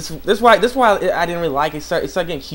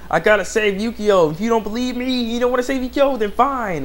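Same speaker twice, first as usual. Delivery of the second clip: raised-pitch retold protest; acted insistence; 7 semitones higher than usual. Character insisting on saving Yukio, mock defiant. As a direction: argue it out, mimicking a stubborn character.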